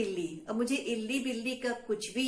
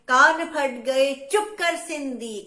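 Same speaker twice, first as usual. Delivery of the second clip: loud mock yell in rhyme; shouting; 5 semitones higher, 10 dB louder, slower than usual. A narrator's reading. Sisters yell at Sindhi to shut up, loud. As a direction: yell it with hands over your ears. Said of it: "कान बंद करके चिल्लाई".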